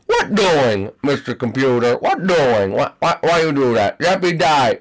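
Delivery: ordinary delivery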